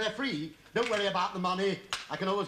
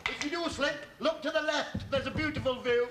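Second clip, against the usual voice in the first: calling down a ladder; 6 semitones higher than usual. Acted.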